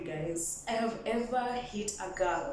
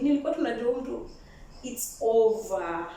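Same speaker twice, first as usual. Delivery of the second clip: normal talking voice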